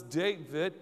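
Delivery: ordinary talk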